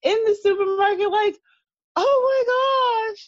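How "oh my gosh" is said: very high, drawn-out mock squeal; imitated high-pitched screaming reaction; retold scream. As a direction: squeal it high and slow, without shouting. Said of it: "like, screaming".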